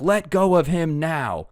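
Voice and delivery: retold yell at the attacker. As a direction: order her to let go, slowly. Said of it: "he yells at the Karen".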